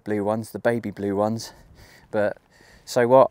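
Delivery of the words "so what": defiant. Real, not acted. Defiant shrug about shoe colour.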